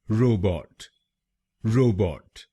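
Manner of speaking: रोबोट said clearly as ro-bot, each syllable crisp; correct pronunciation. Shown as a demonstration. Teacher showing viewers the right way, rोबोट as ro-bot. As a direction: say रोबोट as a model, ro then bot, falling at the end. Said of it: "इसकी सही pronunciation है".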